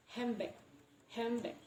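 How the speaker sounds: handbag said as "hambag"; assimilated M. Shown as a demonstration. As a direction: turn the N-D in handbag into an M. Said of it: "it will become M".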